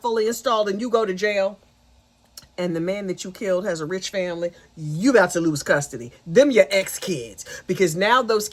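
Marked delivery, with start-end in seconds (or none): none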